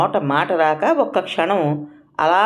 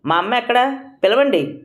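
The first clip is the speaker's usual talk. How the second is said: curt, sharp demand from the son; rude demand; 8 semitones higher than usual. A narrator's reading. Son storming in, demanding his mother rudely. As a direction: snap it out rudely. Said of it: "దురుసుగా అన్నాడు".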